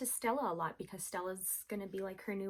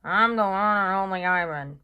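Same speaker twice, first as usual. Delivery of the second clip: louder, reluctant gorilla voice; grudging mutter; 12 dB louder than usual. Acted. Ivan giving in to Bob, grudging. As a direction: give in reluctantly. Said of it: "I mutter".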